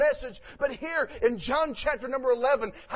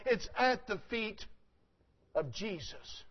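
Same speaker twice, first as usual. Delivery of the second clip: hushed ending; 8 dB quieter than usual. Acted. Preacher drops to hush.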